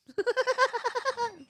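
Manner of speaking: high helpless cackle of laughter; real giggling fit; burst of laughter. Real, not acted. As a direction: break up laughing at your own mistake. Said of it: "I'm amusing myself here".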